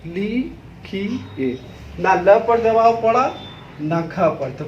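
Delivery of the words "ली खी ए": ली खी said softly, one by one; slow, separate ली खी; syllable demo. Shown as a demonstration. Teacher spelling ली खी aloud for pupils. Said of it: "फिर पुनः बोलिए".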